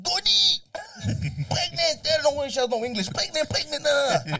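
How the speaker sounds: high, loud broken-English mum; angry mother. Retold or imitated. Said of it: "Ma comes in".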